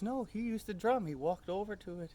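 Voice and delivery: put-on high child's voice insisting; little girl. Daughter telling the tourists about the drum, high insisting voice. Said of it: "She says".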